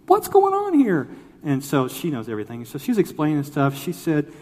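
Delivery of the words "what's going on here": very high, loud comic squeal of a question; squeaky mock disbelief; mock alarmed. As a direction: imitate a flustered visitor, voice shooting way up. Retold question to the nature center lady.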